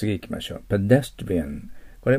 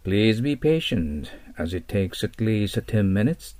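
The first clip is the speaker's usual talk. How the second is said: slow example sentence; much slower than usual. Shown as a demonstration. Teacher reading an example sentence slowly to the class. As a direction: read slowly and clearly. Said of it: "どうぞpatientにお願いします".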